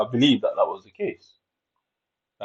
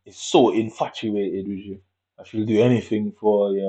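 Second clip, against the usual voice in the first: usual speaking voice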